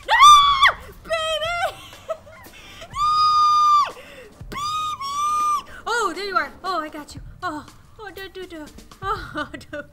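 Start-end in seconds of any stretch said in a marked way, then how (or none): 0.1-5.7 very high, loud frantic shrieks; shrieking in panic. Real, not acted. Babies in danger again.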